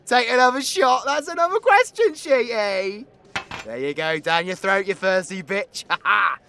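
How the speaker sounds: high, loud shout ending in a laugh; rowdy egging-on. Acted. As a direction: holler at your mate to down it. Egging on friend to drink.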